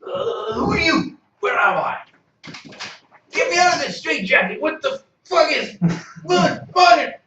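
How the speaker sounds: high, loud frantic shouting; scream, terrified and confused; panicked yelling prisoner. Acted. NPC panics in straitjacket.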